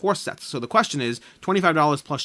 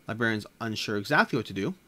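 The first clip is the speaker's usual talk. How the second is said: ordinary talk, nothing marked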